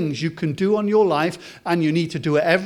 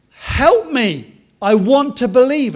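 higher and louder, a father crying out for help; desperate plea; 7 semitones higher, 8 dB louder than usual. Acted. Father of the possessed boy pleads with Jesus, loud desperate cry. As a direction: beg loudly, voice lifted in desperation.

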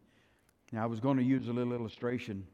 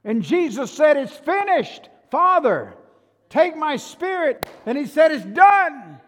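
shouted preaching, pitched very high; loud, high pulpit shout with stretched words; full-force preaching; 15 dB louder than usual. Acted. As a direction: cry it out in triumph to the whole church.

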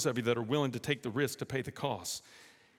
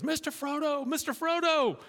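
calling out; 12 semitones higher than usual. Acted. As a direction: call after him as he rows away. Sam calling after Frodo's boat.

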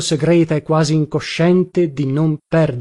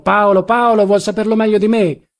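higher, louder contradiction; exasperated insistence; 5 semitones higher, 3 dB louder than usual. A narrator's reading. Son correcting his father's name, exasperated. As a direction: repeat it firmly with raised pitch and volume.

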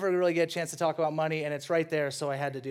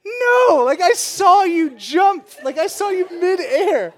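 much higher, louder, slow exasperated yell; incredulous shout; 10 semitones higher, 9 dB louder, slower than usual. Retold or imitated. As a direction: call out the toddler's lie in disbelief. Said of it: "I go".

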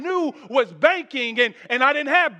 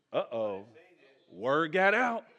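usual speaking voice

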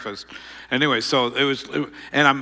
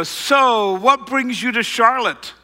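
higher, chatty, bluffing familiarity; faked small talk; 8 semitones higher, 4 dB louder, slower than usual. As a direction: act like an old friend. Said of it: "I'm gonna fake it".

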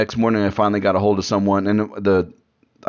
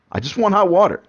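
whiny complaint; 11 semitones higher than usual. Real, not acted. Complaining about no hot water.

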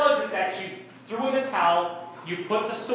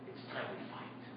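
whispered rallying call; urgent whisper; 20 dB quieter than usual. Real, not acted. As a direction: drop to a whisper to make them lean in. Charge to the men.

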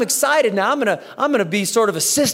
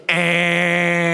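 annoying nasal noise; 2 semitones lower than usual. Retold or imitated. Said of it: "he just goes".

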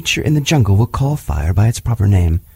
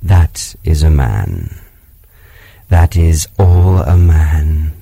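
panther to himself; 7 semitones lower, 6 dB louder, slower than usual. A narrator's reading. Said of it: "said Bagheera to himself".

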